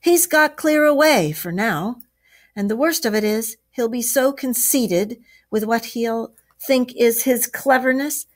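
high, heated complaint. A narrator's reading. Badger complains that Toad got away, heated. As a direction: rail hotly, starting loud and high. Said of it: "replied the Badger hotly".

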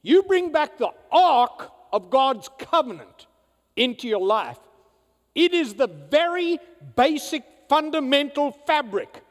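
loud, high, fervent preaching; preaching at full force. Acted. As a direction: build it to a shouting climax.

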